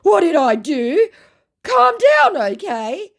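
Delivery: very high, loud mock bewilderment; confused scammer. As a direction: wail it as a baffled scammer. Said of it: "said my now completely confused scammer".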